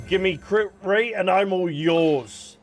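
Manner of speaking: ordinary talk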